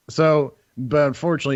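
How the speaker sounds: usual speaking voice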